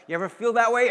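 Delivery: normal talking voice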